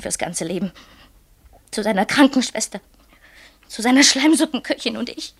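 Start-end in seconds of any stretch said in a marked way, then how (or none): 1.7-5.3 building to a loud, higher cry; rising anguish. Acted. Therese crying out her anguish over her marriage. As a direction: let it break out louder and louder.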